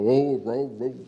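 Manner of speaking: a woman faking a man's voice to sound like God; voz de homem fingida, mais grave; mock man's voice. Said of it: "ela faz a entonação de voz de homem".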